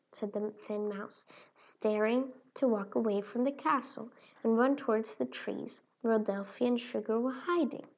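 ordinary delivery

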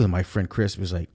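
ordinary delivery

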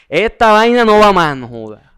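high, loud burst of exasperation, dropping off at the end; loud, exasperated mock quitting; fed-up outburst. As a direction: act out throwing in the towel. Acting out wanting to quit.

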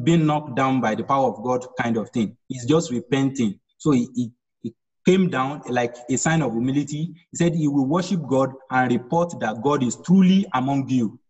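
ordinary talk, nothing marked

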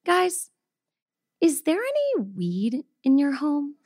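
a hint of a hushed, careful vet; vet's question. Vet quietly asking the owners about weed at home. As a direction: ask it quietly, a little awkward.

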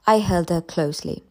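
ordinary delivery, nothing marked

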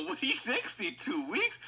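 high, slow disbelief; stunned retelling; incredulous. Retold or imitated. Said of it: "I go".

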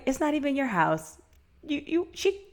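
normal talking voice

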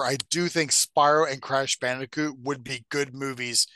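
ordinary talk, nothing marked

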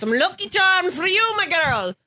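slow, high Irish granny lilt; exaggerated stage-Irish accent; Irish-accented grandmother. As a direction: ham up an Irish grandmother, drawn out. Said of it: "what my grandmother sounded like".

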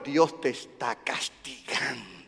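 low, quiet, slow mock accuser; a hint of a gloomy scold; mock accusing voice. Preacher mimicking believers who blame God. Mocking what people say.

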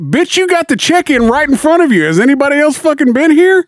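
rising, indignant, incredulous repeat; exasperated mock question. Retelling the hospital nurse, outraged rising repeat.

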